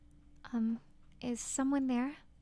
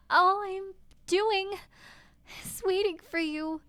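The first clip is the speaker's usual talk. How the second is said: higher, tearful and stammering; pleading heroine; 6 semitones higher, 4 dB louder than usual. Acted. Wife pleading with her husband, near tears. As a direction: beg him, voice breaking.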